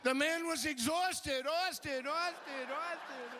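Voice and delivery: high, slow line that echoes away; echoing speech. Pretend. Speaker at the convention declaring Wilt exhausted into the PA. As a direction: drag it out and let it echo off.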